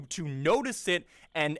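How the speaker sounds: ordinary delivery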